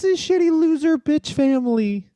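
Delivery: cartoonish escalated tantrum; mock outrage. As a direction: pile on the insults in a silly shrill voice. Escalating joke rant.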